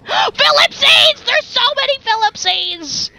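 gasp, then high delighted squeal; high-pitched excitement; excited squeal. Real, not acted. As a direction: squeal at a wonderful sight. Finds a crowd of rats.

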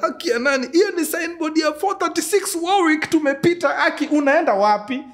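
high, loud frantic begging; panicked pleading. Retold or imitated. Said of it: "So, analia".

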